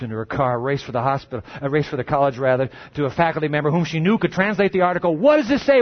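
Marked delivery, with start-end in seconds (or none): 5.2-5.8 high, loud, frantic question; urgent, excited plea. A narrator's reading. Aggie urgently asks translator.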